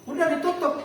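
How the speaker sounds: normal talking voice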